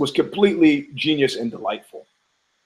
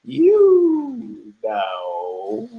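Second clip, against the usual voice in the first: parrot punchline reprise; 9 semitones higher than usual. Acted. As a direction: stretch both words like the knowing parrot. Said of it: "what he was saying at that point was like".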